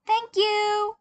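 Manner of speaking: put-on high, loud little-kid thank you; sweet child voice. A narrator's reading. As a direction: thank them like a cute kid. Tim's innocent act.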